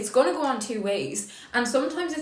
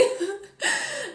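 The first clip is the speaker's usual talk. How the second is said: teary laugh; 12 semitones higher than usual. Real, not acted. Laughing at her own joke.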